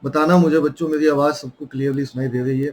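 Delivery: normal talking voice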